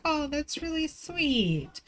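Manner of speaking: sweetly brushing off her husband, then the threat; mock sweet. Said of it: "I'm like".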